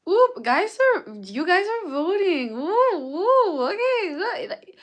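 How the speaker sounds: very high, loud excited squeal; excited whooping. Real, not acted. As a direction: whoop loudly as the votes roll in. Excited as viewers' votes come in.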